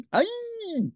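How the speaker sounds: very high puppet shriek; Ernie scream. A narrator's reading. Ernie seeing a shape in the dark, high scream. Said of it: "screamed Ernie".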